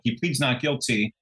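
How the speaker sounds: normal talking voice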